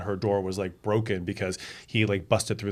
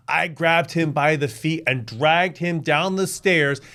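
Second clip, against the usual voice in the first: imitated loud, slow storytelling, pitched up; loud boastful father; 7 semitones higher, 6 dB louder, slower than usual. Father retells dragging drunk son downstairs, imitated loud telling. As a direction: hammer it out slowly, loud and high.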